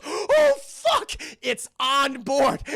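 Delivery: high, loud startled cry. Retold or imitated. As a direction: gasp it out in shock. Ripley's frightened cry voiced.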